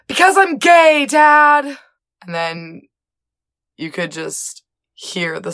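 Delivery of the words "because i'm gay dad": much higher, louder big announcement; dramatic reveal. Retold or imitated. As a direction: land the confession like a theatrical climax. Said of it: "long, dramatic pause".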